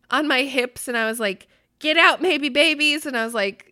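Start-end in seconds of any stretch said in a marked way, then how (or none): 1.8-3.1 louder, higher playful shout at the babies; mock yell. Mom to unborn babies, hips moving: mock yell. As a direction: yell it out like shooing someone off. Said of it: "yelling at them or whatever".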